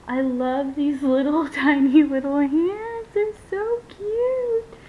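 adoring coo climbing into a long high squeal; gushing coo. Real, not acted. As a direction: melt over something tiny, pitch rising. Gushes over tiny doll hands.

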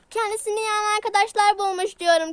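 loud repeat into the ear; shouting. Acted. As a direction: shout it so the deaf one hears. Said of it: "Bağırma kulağıma sağır gibi ya". (kulağına bağırarak)

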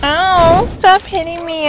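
high-pitched yelp of complaint; pained protest. Real, not acted. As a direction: protest the hits, voice jumping up. Getting hit in the game.

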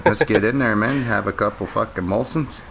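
lightly put-on Canadian hoser talk, "eh, boy"; a beer-loving small-town Canadian, easygoing; Canadian hoser accent. Hoser banter while gaming.

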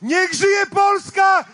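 rally cry. Real, not acted. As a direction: rouse the crowd with a slow shout. Rally cry ending the speech.

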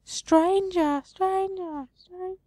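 high, fading echo of one word; the tree calling out to the traveler, high and fading. Acted. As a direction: let each call fade into the distance. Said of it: "The voice is faint yet echoing".

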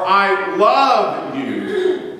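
"love" stretched very slowly with a rise; stress on LOVE. Shown as a demonstration. Preacher pouring the feeling into the word love. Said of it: "it's really stressing the feeling".

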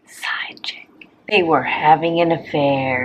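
hushed gossip whisper. Acted. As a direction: whisper it like a secret.

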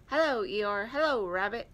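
a hint of a cheery storybook greeting; Roo. A narrator's reading. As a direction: lift and project it a little. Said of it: "said Roo".

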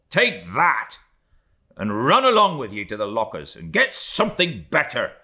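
mate scolding the steward, then excusing himself to Starbuck; blustering mate. Acted. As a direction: shoo him off with a scolding. Stubb scolds and orders.

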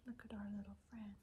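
hushed picture-book aside; whispered aside. Real, not acted. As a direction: lean in and whisper about the picture.

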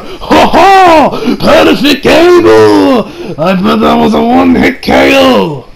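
high, loud, slow hammed-up gloat; gloating villain.

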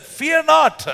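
high, loud, commanding Fear not; bold prophet. Acted. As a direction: declare it like a fearless prophet. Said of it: "And he answered".

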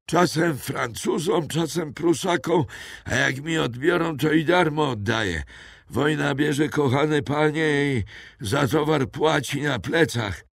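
put-on frightened old poacher naming himself to captors; old poacher Mathieu. A narrator's reading. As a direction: play a wary old woodsman.

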